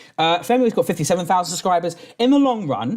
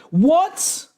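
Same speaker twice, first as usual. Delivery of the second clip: startled high what; 10 semitones higher than usual. Real, not acted. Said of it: "My accent went finish with that fucking laugh".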